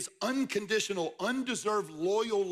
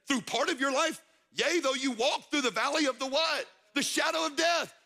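preaching climax; 7 semitones higher than usual. Acted. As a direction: rise in pitch and power until you shout The shadow of death.

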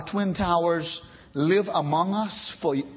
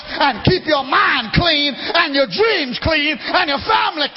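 preaching shout; 6 semitones higher, 5 dB louder than usual. Real, not acted. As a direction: thunder the warning at the congregation. Preacher warns congregation about movies.

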